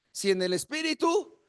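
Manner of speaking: ordinary talk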